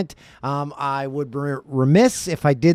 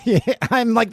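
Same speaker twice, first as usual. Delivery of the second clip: laughing through the words; amused laugh while agreeing; 9 semitones higher than usual. Real, not acted. Co-host laughing at the Nate MacKinnon quote.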